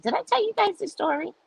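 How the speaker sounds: squeaky aside. Real, not acted. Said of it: "aside in a squeaky voice".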